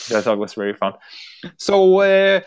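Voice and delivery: ordinary talk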